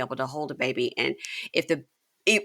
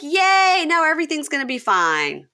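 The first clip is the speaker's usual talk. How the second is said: much higher, louder mock-delighted yay; sarcastic cheer; 11 semitones higher, 5 dB louder than usual. Mocking the hug scene's happy ending, sarcastic high excited cheer. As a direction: fake glee that it's all fixed now. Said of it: "And I'm like".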